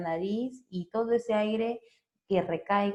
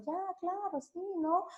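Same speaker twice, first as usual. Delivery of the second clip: agreeable; 11 semitones higher, 8 dB quieter than usual. Retold or imitated. Agreeable reply to kind request.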